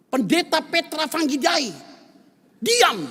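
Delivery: put-on high, loud shout of a scolding child; child's shout. Eldest child yells at arguing father, loud high shout.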